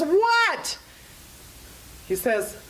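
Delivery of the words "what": a high, strained yelp of a hysterical man; mock hysterical answer. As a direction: shriek it as a frazzled man at his wits' end. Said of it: "Hysterical voice says".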